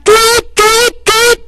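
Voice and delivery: high chirpy mock siren sound; siren imitation. Preacher mimics the ambulance siren, high chirping.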